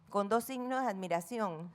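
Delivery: ordinary talk, nothing marked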